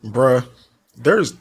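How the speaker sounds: normal talking voice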